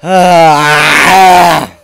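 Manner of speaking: loud wordless scream of rage; frustrated scream. Real, not acted. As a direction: let out a furious cry. Frustrated gamer shout.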